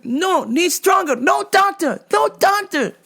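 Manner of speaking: a mock desperate customer begging for a stronger cream; high pleading customer. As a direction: squeak out the demand like a desperate, out-of-it customer. Customer refusing again.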